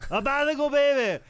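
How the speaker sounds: loud, high lisping wrestler shout; Dusty Rhodes. Acted. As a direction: holler it like a hyped-up wrestling promo. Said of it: "do a Dusty Rhodes impersonation".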